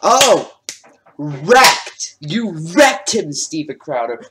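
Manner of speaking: loud mock celebration, drawn out; sarcastic cheer. Real, not acted. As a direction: cheer sarcastically as if it was a great burn. Mocking Crowder's weak burn.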